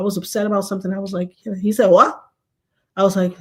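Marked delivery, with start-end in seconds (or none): 1.9-2.2 short, louder "what?"; mock-baffled, louder query; puzzled husband. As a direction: imitate a husband who can't hear. Husband straining to hear her.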